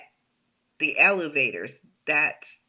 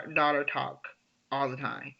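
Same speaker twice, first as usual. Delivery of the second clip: normal talking voice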